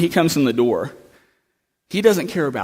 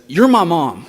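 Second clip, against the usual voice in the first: emotional realization; 5 semitones higher, 7 dB louder than usual. Retold or imitated. Girl realizing to her mom.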